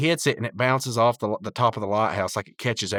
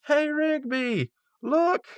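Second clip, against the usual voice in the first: very high, drawn-out falsetto call; Eileen impression; much slower than usual. Calling Rigby over on the golf course, high and drawn out. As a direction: call out slowly in a mock girlish falsetto.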